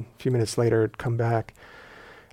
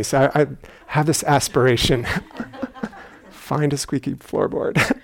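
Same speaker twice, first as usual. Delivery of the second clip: ordinary delivery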